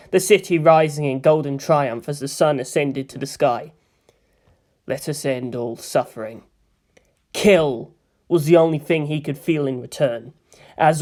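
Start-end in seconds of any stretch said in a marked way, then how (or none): 4.9-6.4 murmured invocation. Acted. Pilot softly prays to his machine before launch. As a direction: chant to the machine inside. Prayer continues.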